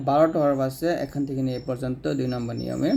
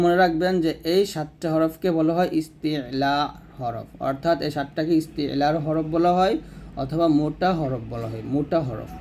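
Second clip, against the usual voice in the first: ordinary delivery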